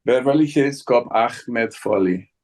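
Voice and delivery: slow, deliberate Serge accent; measured delivery in Serge's foreign accent. Shown as a demonstration. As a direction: say the title slowly in Serge's accent. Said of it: "I need to speak Serge better".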